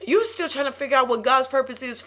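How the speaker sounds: high, loud, fired-up preacher; sermon at full force; preaching. Real, not acted. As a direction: preach it hard, driving to the last word. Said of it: "You preaching that thing".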